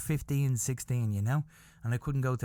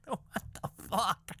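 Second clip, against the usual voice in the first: high, slow words through laughter; softer, breaking up with laughter; laughing disbelief; 7 semitones higher, 3 dB quieter, slower than usual. Real, not acted. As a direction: weakly laugh out the question. Laughing again at the punchline.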